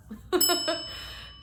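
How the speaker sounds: high breathy disbelieving laugh. Real, not acted. Over the wasted appointment, a disbelieving laugh.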